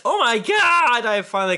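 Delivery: high, breathless oh my God; excited gush. Pretend. Reading a fan review aloud, excited gush.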